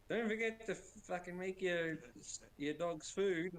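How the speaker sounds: lightly voiced nagging wife, slow at the end. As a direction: imitate the wife reminding him about dog food. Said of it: "but no".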